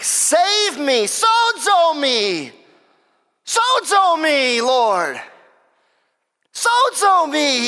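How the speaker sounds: high, drawn-out cry to be saved; shouted plea. Retold or imitated. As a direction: call out slowly like a sinking man. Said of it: "he shouted".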